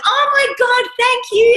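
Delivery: high, loud flustered thanks; embarrassed surprise. Retold or imitated. Told about sticker at school, high loud flustered thanks. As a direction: gasp it out, caught off guard. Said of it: "And I went".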